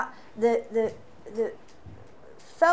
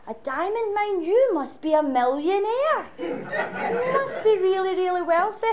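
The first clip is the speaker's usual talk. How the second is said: put-on amazed child; 7 semitones higher than usual. Glasgow boy to his Mozambique classmate, amazed high voice. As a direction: play the wee boy gasping at the news.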